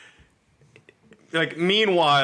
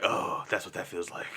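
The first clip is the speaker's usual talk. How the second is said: quiet Batman quote; 5 semitones lower, 9 dB quieter than usual. Retold or imitated. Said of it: "and he's like".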